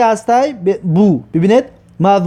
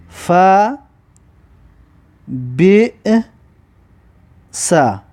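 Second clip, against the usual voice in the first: "فا", "بی", "سا" each alone, long pauses between; syllable-by-syllable dictation; 2 semitones lower, much slower than usual. Shown as a demonstration. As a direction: dictate it one drawn-out syllable at a time. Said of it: "بنویسید به این طریق که".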